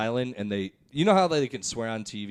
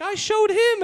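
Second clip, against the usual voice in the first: much higher put-on grandma scolding; 8 dB louder than usual. Grandma catching grandson watching an R movie, high scold. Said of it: "the tone of voice".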